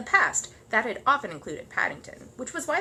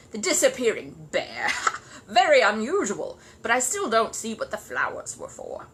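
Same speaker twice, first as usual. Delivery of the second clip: loud, slow, pompous praise; gruff know-all neighbour; 5 dB louder than usual. Acted. Grumpy neighbour praising the bear grudgingly, pompous. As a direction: condescend to the bear. Mr Curry mocking.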